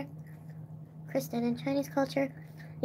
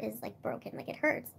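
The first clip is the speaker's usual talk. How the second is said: much softer, fading to a whisper; hushed; 3 semitones lower, 10 dB quieter than usual. Real, not acted. Telling friends how grief feels, whispering. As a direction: let it drop to a whisper. Describing heartbreak softly.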